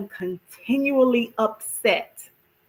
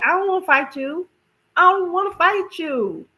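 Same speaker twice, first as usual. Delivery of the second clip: pleading; 9 semitones higher than usual. Pretend. Voicing a conflict-avoidant person.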